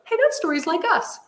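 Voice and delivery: high-pitched imitation of a child; little son. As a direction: voice a small boy noticing something. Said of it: "Hudson goes".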